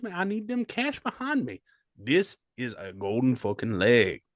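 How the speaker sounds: ordinary delivery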